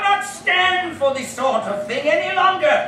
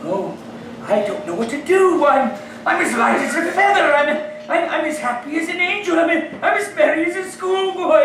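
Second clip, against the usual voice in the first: ordinary delivery, nothing marked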